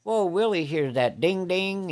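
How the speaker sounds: normal talking voice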